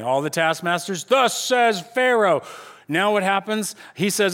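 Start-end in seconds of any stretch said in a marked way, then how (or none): 1.0-2.4 slow, loud, high herald call; proclaiming. Retold or imitated. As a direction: boom it out slowly like a royal herald. Contest of proclamations, taskmasters side.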